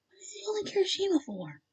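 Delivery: hushed, breath-only whisper; whispered question. A narrator's reading. Listener alone in the room wondering, whispering. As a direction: whisper it in puzzlement.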